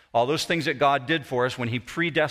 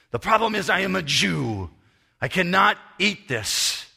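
put-on raised, emphatic Hebrew-accented complaint; thick Hebrew accent; 5 semitones higher, 3 dB louder than usual. Frustrated elderly man tells visitor his problem, thick Hebrew accent. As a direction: explain it indignantly, high and emphatic, in a Hebrew accent. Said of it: "in a very thick Hebrew accent".

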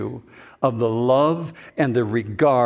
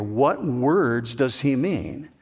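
ordinary delivery

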